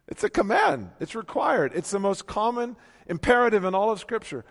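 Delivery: loud, high, ringing preacher's climb; full-voiced pulpit proclamation; preaching at full force. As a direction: lift it up and proclaim. Preaching climax.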